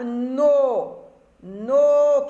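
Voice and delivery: "no" held long and loud; emphasized syllable. Shown as a demonstration. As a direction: hit and stretch "no" as the key syllable. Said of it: "c'est la syllabe".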